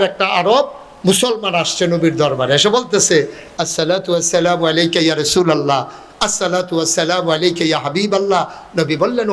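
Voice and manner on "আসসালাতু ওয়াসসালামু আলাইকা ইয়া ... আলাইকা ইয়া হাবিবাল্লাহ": rapid spoken Arabic salutation; quick greeting. Acted. Bedouin arriving at the Prophet's court, quick greeting. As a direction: rattle off the salutation in an everyday pitch.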